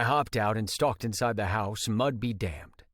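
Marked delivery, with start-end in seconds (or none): none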